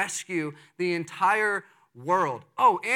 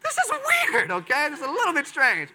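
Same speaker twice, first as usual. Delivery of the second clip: amused laugh; 11 semitones higher, 4 dB louder than usual. Real, not acted. Amused aside after the comic scene.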